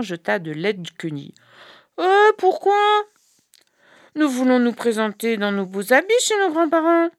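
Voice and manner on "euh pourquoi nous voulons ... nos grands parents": put-on high, whiny child's protest; protesting children. The sons protesting to their mother about the rags, whiny. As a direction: complain about the ugly rags.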